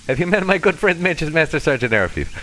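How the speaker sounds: mock-Russian introduction of a friend; a put-on Russian accent, making introductions; fake Russian accent. Introducing his good friend, fake Russian accent. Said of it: "terrible fake Russian accent a little thick".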